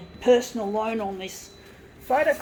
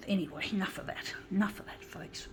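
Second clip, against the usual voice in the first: whisper; 3 semitones lower, 8 dB quieter than usual. Real, not acted. Calming down after the rant.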